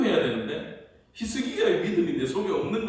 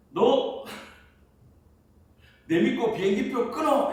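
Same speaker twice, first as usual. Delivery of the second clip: high, laughing command from above; God's voice; 8 semitones higher, 4 dB louder than usual. Retold or imitated. God telling the pastor to buy the plane ticket, high and laughing. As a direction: boom it out like God giving an order with a chuckle. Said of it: "이러는 거야".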